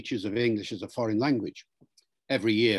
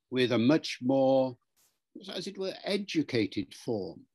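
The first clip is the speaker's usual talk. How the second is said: usual speaking voice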